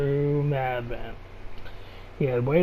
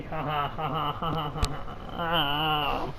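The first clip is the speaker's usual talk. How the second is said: fake laugh; 6 dB quieter, much slower than usual. Real, not acted.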